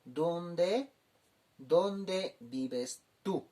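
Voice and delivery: very slow, clear gloss; teacher spelling out the meaning; slow translation. Shown as a demonstration. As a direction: drag it out for learners. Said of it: "Kaukansa humaha kamta".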